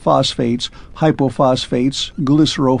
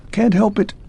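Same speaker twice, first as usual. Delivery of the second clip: higher, jerky mechanical man; a hint of a clockwork man, higher; clipped clockwork man; 8 semitones higher than usual. A narrator's reading. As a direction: rattle it off like a faulty machine.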